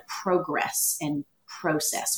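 "progress and process" with a long o in each first syllable; slow, deliberate long o in "progress and process"; British-style vowels. Shown as a demonstration. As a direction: say "progress and process" as PROH-gress and PROH-cess. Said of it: "a bit more British".

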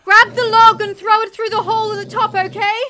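high, very loud urgent yell; yelled order. A narrator's reading. Mother to Harry with dingoes trapped, yelled order. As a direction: yell instructions over the danger. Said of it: "I yelled".

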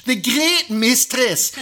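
high, loud Scottish slip; slow, exaggerated Scottish flourish, pitched high; Scottish accent. Game master slipping out of Cockney by mistake, going loudly Scottish. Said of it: "now I'm going Scottish".

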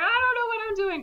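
very high-pitched panicky imitation; mock panic. Reluctant co-pilot made to fly the plane, mock panic. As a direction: squeal it like an out-of-his-depth pilot.

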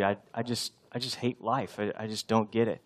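low, soft, halting confession; dying inside. Acted. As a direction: quietly voice someone who hates life. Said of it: "on the inside, you're, you're dying".

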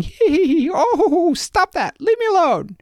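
very high, loud helpless giggling; giggling goblin. Acted. Goblin tickled under the armpits, high giggling pleas. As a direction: giggle while begging him to stop. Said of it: "the goblin started going".

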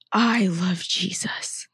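imitating a slow, frightened whisper; whispered quote. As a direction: whisper it wide-eyed, like the confused patient. Patient quoted in nurse's story.

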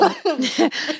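high breathy laugh while choking. Real, not acted. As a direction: laugh through a coughing fit. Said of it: "It goes down the wrong way".

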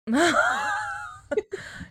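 very high shrieking laugh; squealing laugh. Real, not acted. Laughing at the skeleton photos.

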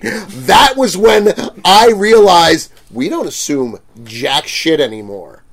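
normal talking voice

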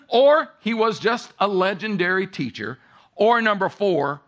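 impassioned sermon delivery; preaching at full force. Acted. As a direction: preach it out with rising fervor. Preacher urges a decision.

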